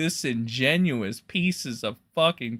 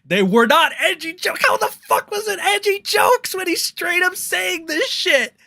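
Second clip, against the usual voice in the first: furious disbelief; angry rant; 11 semitones higher than usual. Real, not acted. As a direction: rail at him in outrage. Angry rant at Ethan Klein.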